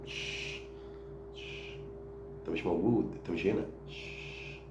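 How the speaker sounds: soft, taunting hush with long shh; hushed mockery, shh then أنت مش هنا; teasing shush. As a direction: quietly wave him away as if he isn't there. Hushing rival, telling him he's absent.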